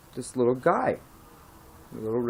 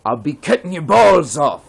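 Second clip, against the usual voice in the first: a slightly raised, louder Irish-tinged warning; threatening bartender; 4 semitones higher, 5 dB louder than usual. Acted. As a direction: lean in and threaten the troublemaker. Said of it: "And he says".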